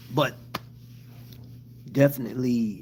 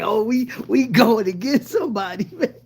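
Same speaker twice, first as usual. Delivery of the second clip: higher, louder laughing imitation; hyped quote; 10 semitones higher, 6 dB louder than usual. Retelling friend's hyped words, laughing. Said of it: "he like".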